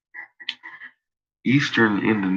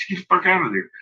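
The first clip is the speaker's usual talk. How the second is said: urgent warning; 9 semitones higher, much faster than usual. Retold or imitated. Urging friend to leave.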